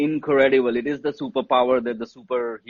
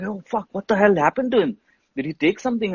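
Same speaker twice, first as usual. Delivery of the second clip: higher-pitched imitation of alarmed friends; retold alarm; 7 semitones higher than usual. Friends react to a fan collapsing at the afterparty, retold alarm. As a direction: mimic a friend's worried reaction.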